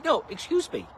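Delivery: high, loud indignant retort; indignant no, excuse me; annoyed rebuttal. Real, not acted. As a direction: correct them, offended. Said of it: "I'm still mad".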